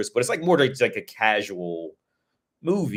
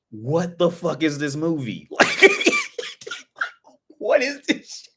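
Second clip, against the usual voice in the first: high, slowed, incredulous cry breaking into a laugh; baffled outburst; 8 semitones higher, 3 dB louder, slower than usual. Retold or imitated. Viewer baffled by the Tom Cruise bar scene, incredulous high cry. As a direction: let the disbelief crack into a laugh.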